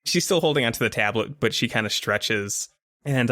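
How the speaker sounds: normal talking voice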